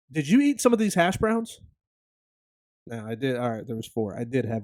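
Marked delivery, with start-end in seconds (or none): 0.1-1.6 imitating his wife. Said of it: "I'm getting a call".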